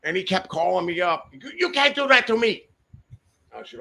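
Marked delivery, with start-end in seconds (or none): 1.3-2.6 high, loud imitated protest; protesting foreign buyer. Panicked buyer calls seller back, high mock protest. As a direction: plead loudly in a raised, put-on voice.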